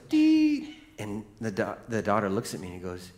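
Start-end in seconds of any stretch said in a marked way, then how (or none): 0.1-0.8 high, held monitor tone; flatline whine. Retold or imitated. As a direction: sing out the flatline beep. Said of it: "so the line goes".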